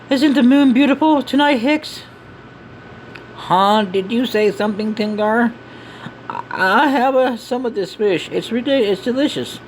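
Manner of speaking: high-pitched character voices; game companions chatting. Acted. Companions chatting over fish under the moon, high character voices. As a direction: keep the dialogue pitched high.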